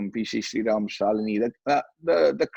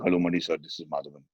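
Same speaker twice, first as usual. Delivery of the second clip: much lower, softer, very proper phone voice; polite changed voice; 6 semitones lower, 4 dB quieter, faster than usual. Retold or imitated. Young actor disguising his voice on the phone to Mani Ratnam, low and proper. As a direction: drop the pitch to sound respectful and different. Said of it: "I'm trying to change my voice now".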